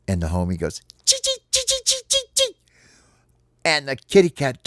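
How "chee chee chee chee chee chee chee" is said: very high, sharp little squeaks; rapid-fire taunting chirps; critter chittering. Acted. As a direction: squeak teasingly like a tiny rodent. Homie squeaks at the cat again.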